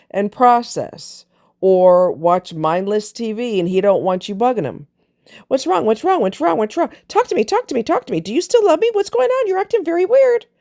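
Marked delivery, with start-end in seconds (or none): 5.5-10.5 much higher, needy pestering voice; nagging wife. Retold or imitated. Wife pestering her brooding husband, in a high whiny voice. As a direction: whine the questions over and over like a clingy wife.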